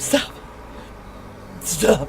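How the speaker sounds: loud, hard shouted order; furious cry to stop; shouted command. Acted. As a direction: bark it as a demand. Demanding car stop.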